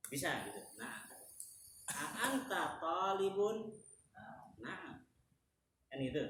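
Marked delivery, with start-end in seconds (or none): 1.9-3.7 "A anta talibun" slow, higher in pitch; question intonation. Shown as a demonstration. Teacher posing an example question, "A anta talibun" slow and raised. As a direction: ask "A anta talibun" drawn out and lifted. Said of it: "dalam berintonasi ketika ngobrol".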